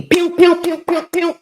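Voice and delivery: high, punchy pew pew noises; mock blaster sounds. Shooting the demons down as they flee, in high playful pew noises. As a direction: fire off toy laser-gun sounds. Demons fleeing, imitated shots.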